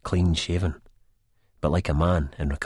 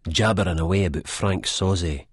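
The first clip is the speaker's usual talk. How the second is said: usual speaking voice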